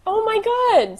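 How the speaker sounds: high astonished cry; shocked. Real, not acted. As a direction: gasp it out, stunned. Reacting to Larry reveal.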